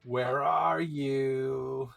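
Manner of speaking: slow, drawn-out booming call. Acted. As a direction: boom it across the district. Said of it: "you hear a booming voice over the entire district".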